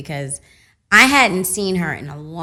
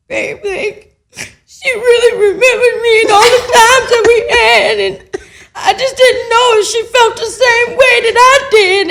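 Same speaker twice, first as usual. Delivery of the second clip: high, loud fake sobbing while telling; crying; 13 dB louder than usual. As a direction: blubber it out. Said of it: "Why you sound like an old man when you cry?".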